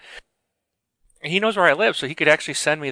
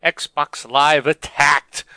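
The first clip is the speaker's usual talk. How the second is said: slow, louder show-title announcement; announcer voice; 3 semitones higher, 5 dB louder, much slower than usual. Acted. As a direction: announce the show name slowly.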